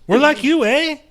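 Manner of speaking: put-on squeaky ham Canadian accent, end on a rising eh; hammed-up mock Canadian accent. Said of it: "Canadians dressed up like rednecks".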